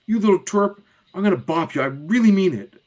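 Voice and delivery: put-on high, loud girlish threat; acted indignant kid, pitched high; angry big sister. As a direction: threaten a little brother. Julie threatens her brother.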